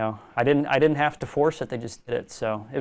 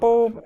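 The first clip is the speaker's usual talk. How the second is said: high electronic beeping sound; alarm beep imitation; 9 semitones higher, 5 dB louder than usual. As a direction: mimic a fire alarm beep.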